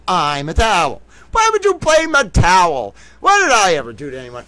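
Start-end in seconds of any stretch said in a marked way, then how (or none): 1.3-4.5 mock indignant cry. Towel persona protesting blame, hammed-up high squeal. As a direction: squeal it like the wronged party.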